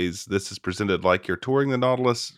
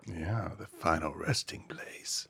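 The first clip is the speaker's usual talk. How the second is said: hushed spooky whisper; 4 semitones lower, 12 dB quieter, slower than usual. Pretend. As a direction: breathe it like a ghost story. Spooky echo of exhibit name.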